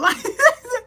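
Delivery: very high, squealing laugh. Real, not acted. Laughing at her own retold panic, high laugh. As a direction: crack up at your own freak-out.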